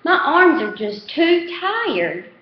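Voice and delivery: slow, high drawn-out whine; whining little boy. A narrator's reading. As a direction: whine like a tired four-year-old. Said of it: "he whines".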